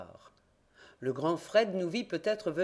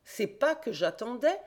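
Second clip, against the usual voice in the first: higher, eager outcry; triumphant discovery; exclaiming; 6 semitones higher than usual. A narrator's reading. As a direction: cry it out as he spots the print. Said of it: "s'écria-t-il".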